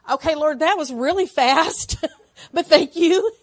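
loud, high, slow laughing gratitude; tearful laughing thanks. Retold or imitated. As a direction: laugh in disbelief while thanking God. Sudden answered prayer, laughing.

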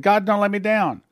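retold cry from a dark cell; desperate cry. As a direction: cry out to God in despair. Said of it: "I yelled out and said".

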